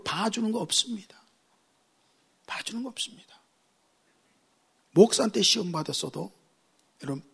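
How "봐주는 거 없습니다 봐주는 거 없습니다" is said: hushed unvoiced warning; stage whisper. Preacher warning congregation in a whisper. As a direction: lean in and whisper the threat.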